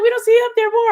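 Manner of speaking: high, loud mock church lady; imitating churchgoers. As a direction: squeal it like an eager church member.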